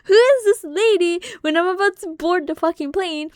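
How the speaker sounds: high, loud, panicked voice of dad; freaked out. Retold or imitated. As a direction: squeal it like a spooked dad, rushing. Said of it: "my dad was freaked out".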